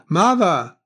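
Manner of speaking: loud, high cry for mother; calling out. A narrator's reading. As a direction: call out for your mother. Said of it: "Someone else was calling".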